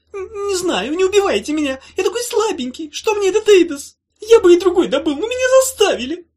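high, loud panicked squeal; cornered little creature blurting excuses; terrified creature. Acted. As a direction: plead in a tiny frantic voice.

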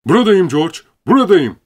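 very high, loud answering shout; Lenny shouting back to George; loud reply. Acted. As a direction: call back across the barn. Lenny answers a call from afar.

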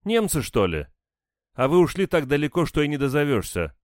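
scornful woman. A narrator's reading. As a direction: keep scoffing at the questioners. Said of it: "фыркнула женщина". (язвительно, высоким голосом)

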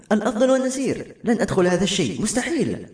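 high-pitched panicky refusal; acted mock yell, frightened and adamant; crying out. A narrator's reading. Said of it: "قالت جملتها الأخيرة صارخة".

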